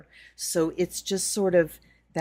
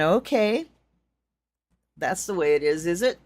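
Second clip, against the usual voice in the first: louder, challenging; defiant retort; 3 semitones higher, 7 dB louder than usual. Retold or imitated. She retells snapping back at the silent Goddess, louder and defiant. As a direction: throw it back as a dare.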